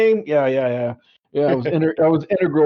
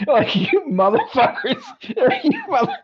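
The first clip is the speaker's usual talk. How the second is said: laughing outrage. Real, not acted. Laughing disbelief at bad posting.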